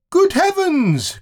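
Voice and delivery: very high, slow squeal of surprise; monkeys crying out. Acted. Monkeys spot the roly-poly bird, high astonished cry. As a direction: shriek it like a troop of monkeys. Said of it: "cried all the monkeys together".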